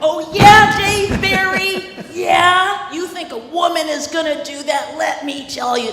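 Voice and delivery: loud, high sing-song taunt; acting out. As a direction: belt it like a sassy showdown. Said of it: "we can do a little bit of acting out for you".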